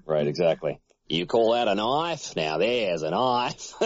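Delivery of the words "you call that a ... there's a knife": put-on slow broad Australian accent; broad Australian movie-hero impression; Paul Hogan. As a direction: drawl it in broad Aussie, drawn out. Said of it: "they just do a Paul Hogan impression".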